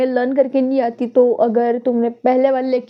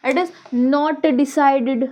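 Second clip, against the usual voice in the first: very slow, every word spaced for writing; slow dictation; much slower than usual. Shown as a demonstration. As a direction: spell it out slowly for students to copy. Teacher dictating notes slowly.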